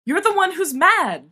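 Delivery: snapping back. A narrator's reading. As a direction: snap it back at him. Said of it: "James snaps".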